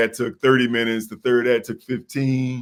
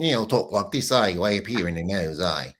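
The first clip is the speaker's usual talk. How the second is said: mock New Zealand accent, up in the nose; New Zealand accent squeezed through the nose; Kiwi accent; 2 semitones lower, 6 dB quieter than usual. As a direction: imitate a nasal Kiwi twang. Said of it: "it's Kiwis, you know, they all".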